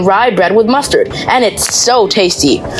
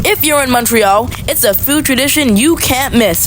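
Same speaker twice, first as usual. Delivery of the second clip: ordinary talk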